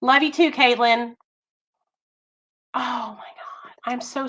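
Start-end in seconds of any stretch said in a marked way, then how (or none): 2.7-4.3 real shocked whisper, slow on sorry. Real, not acted. Apologising to a grieving viewer she scolded, in a whisper. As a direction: breathe the apology, mortified.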